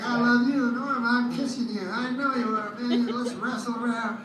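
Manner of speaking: high, eager puppy love; the dog begging to play. Retold or imitated. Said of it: "And he's like".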